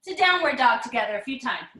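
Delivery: ordinary talk, nothing marked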